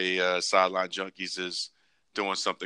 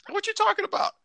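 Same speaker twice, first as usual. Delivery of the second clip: mock wife's reply.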